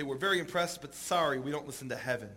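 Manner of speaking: soft, understated apology, sinking at the end; mild deadpan refusal. Retold or imitated. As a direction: let the rejection drop quietly. Rabbis refuse even heaven, deadpan punchline.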